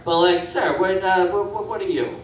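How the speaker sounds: ordinary delivery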